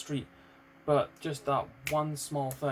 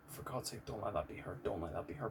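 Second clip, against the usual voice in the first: low, hushed retold plea; quiet praying; 4 semitones lower, 9 dB quieter than usual. Praying it isn't the fiancee, retold in a low, soft plea. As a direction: pray under your breath, dropping lower on the repeat. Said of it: "I'm praying to every deity".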